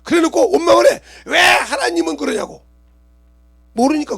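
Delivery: loud, high, almost crying sermon cry; impassioned preaching. Acted. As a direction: plead with the congregation. Preaching at full force. (울부짖듯 외치는 설교)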